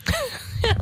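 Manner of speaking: sehr hohes, fremdartiges Kichern; spöttisches Kichern. Acted. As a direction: kicher hoch und spöttisch. Dämon lacht Drohung aus. (hohes spöttisches Kichern)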